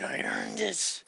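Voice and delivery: slow whisper, voice gone to breath. Retold or imitated. Voicing the father's pride in the kill, whispered slowly.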